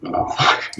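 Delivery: a breathy little gasp of awe; hushed, starstruck under the breath; mock whisper. Retelling the cafe stranger, a soft awed whisper. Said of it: "this is what he said".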